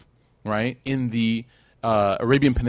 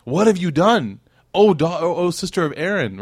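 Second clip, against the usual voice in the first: loud, higher-pitched mock outrage; scandalised accusation; 7 semitones higher, 5 dB louder than usual. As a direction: imitate a crowd accusing her in shock. Acts out the people's shocked accusation.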